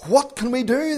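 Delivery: high, loud desperate cry; a high, loud plea for help. Retold or imitated. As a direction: call out in alarm. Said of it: "they cry out".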